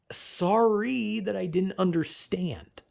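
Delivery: drawn-out, mock-sorry whine; slow, put-upon sarcastic sorry; sarcastic apology. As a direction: stretch it out as an insincere apology. Sarcastic apology to a dismissive teacher.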